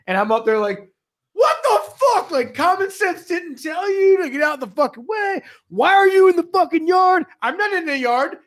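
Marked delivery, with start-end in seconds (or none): 1.3-7.3 very high, incredulous holler; exasperated retold yell. Foreman yelling at driver parked in a yard, exasperated holler. 7.4-8.4 high, whiny mimicry of a denial; mock a driver protesting innocence. Truck driver denying he's in the yard, mocking protest.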